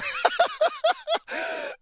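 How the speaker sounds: high squealing laugh; helpless high-pitched laugh. Real, not acted. Friend laughing again.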